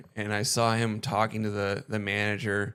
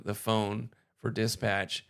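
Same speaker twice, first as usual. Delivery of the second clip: usual speaking voice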